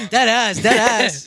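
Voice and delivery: laughing, high and loud. Real, not acted. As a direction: crack up through the words. Laughing mock jeer.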